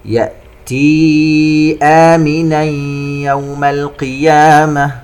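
slow chant, long ee in "يأتي" before "آمنا"; separated madd. Shown as a demonstration. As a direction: stretch "يأتي", hum "آمنا" into "يوم". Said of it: "مد منفصل يأتي آمنا يمد". (ترتيل بمد "يأتي" وغنة "آمنا يوم")

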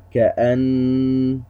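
كأن drawn out slowly, the final noon hummed at the stop; stopping with ghunnah. Shown as a demonstration. A teacher shows how to pause on a doubled noon. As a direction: end on كأن with the noon still humming. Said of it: "هكذا نقف عليها".

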